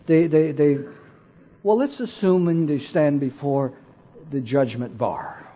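usual speaking voice

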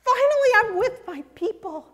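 high, exultant proclamation; loud and excited. Acted. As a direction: announce it like a joyful homecoming. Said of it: "in a loud and excited voice".

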